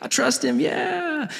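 very high, awkward put-on voice. Churchgoer put on the spot to share, high awkward imitation. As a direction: imitate someone squirming through a stock answer. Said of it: "because you're like, "Oh, this is awkward".

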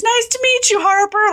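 high, tense politeness; nervous polite dad. Retold or imitated. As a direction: greet her nervously. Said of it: "he's like".

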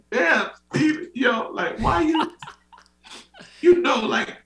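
very high, comic exasperated awe; mock amazed. Fan addressing [name] about beautiful songs, hammed-up amazement. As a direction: gush in high-pitched disbelief.

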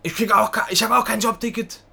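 loud, high mimicked complaint; indignant clerk. Clerk complaining to trainee about her own ticket, loud indignation. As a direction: imitate a worked-up clerk.

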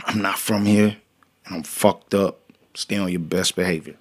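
low, soft self-warning; hushed reminder to behave; muttering to himself. Retold or imitated. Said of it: "I'm saying to myself".

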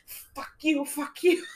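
high, laughing mock curse; mock yell. Teacher picking up trash, laughing mock curses. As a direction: swear at the trash, half-laughing. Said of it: "I'm just yelling at myself".

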